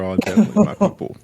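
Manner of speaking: high, quick laughing thanks through giggles. Real, not acted. Host thanking a listener for a funny message, laughing. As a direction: let the giggle lift the whole thanks.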